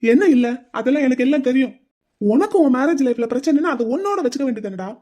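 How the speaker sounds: angry retort. Acted. Groom cutting off his friend's denial, angry. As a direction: shut down his excuse.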